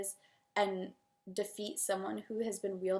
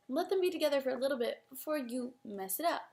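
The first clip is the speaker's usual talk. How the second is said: ordinary talk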